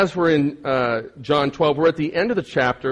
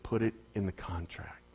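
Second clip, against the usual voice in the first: whispered demand; 7 semitones lower, 16 dB quieter than usual. Retold or imitated. Retold firm demand, whispered.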